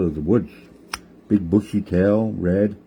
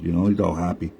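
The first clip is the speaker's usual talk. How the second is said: usual speaking voice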